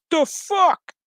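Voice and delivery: sudden high startled cry; startled yelp. Retold or imitated. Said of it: "it's like".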